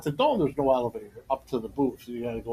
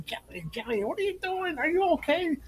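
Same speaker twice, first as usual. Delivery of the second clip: mock worried call; 5 dB quieter than usual. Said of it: "Dietz is like".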